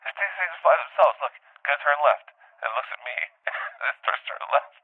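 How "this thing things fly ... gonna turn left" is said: imitating the director. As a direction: ham up the smug director flying the plane. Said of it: "He's like".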